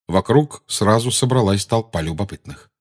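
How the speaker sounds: ordinary delivery, nothing marked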